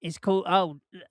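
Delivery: ordinary talk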